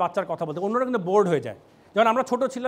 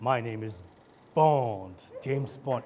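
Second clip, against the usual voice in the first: low, soft, suave spy introduction; James Bond; 9 semitones lower, 4 dB quieter, slower than usual. Acted. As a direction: introduce yourself like a film spy, deep and understated. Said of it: "bond, James bond".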